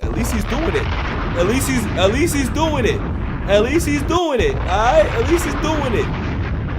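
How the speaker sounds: high, loud, drawn-out hype; hyped approval. Real, not acted. Reactor hyping up the rapper's new track, loud and high. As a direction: build the hype each time.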